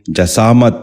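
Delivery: جسامت said "ja-saamat", with a after the j; short a in the first syllable of جسامت; correct pronunciation. Shown as a demonstration. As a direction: say جسامت as "ja-SAA-mat". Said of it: "دوبارہ سنیے".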